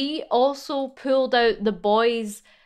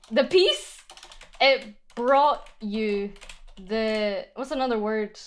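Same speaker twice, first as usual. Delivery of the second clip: poem reading; slower than usual. Acted. Composing poem aloud.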